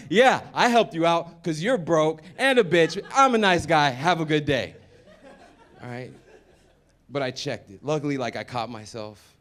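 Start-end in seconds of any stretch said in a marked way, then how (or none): 0.1-4.8 fake-nice while insulting; mock smug kindness. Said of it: "be like".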